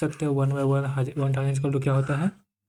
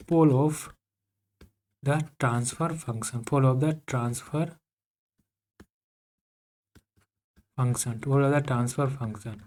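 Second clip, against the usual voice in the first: slow dictation; much slower than usual. Shown as a demonstration. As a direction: let the class write it down. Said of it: "वो होगा pole of a".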